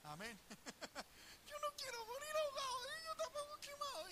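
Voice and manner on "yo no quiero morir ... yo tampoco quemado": put-on much higher whiny child's voice; childish whine. Preacher mimicking himself as a child, high whine. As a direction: squeak it like a scared little boy.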